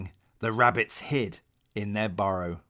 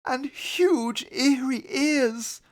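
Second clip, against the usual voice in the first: fearful; 11 semitones higher, slower than usual. A narrator's reading. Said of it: "said fearfully".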